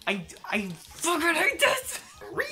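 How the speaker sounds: exaggerated high-pitched gamer rage; rage screech. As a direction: screech it like a meltdown. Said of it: "That's me right now".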